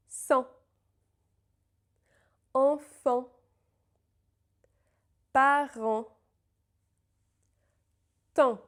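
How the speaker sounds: each nasal word said slowly and clearly, with pauses to repeat; teacher models Sang, Enfant, Parent, Temps for copying; model pronunciation. Shown as a demonstration. Teacher models words for students to repeat, clear and slow. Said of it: "pronounce after me to copy".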